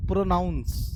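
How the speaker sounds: प्रोनाउंस with a full "noun" sound; pronunciation demo. Shown as a demonstration. Teacher showing how the verb sounds, प्रोनाउंस with "noun" inside. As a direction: say प्रोनाउंस carefully as pro-nounce. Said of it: "आपको उच्चारण करना है इसको".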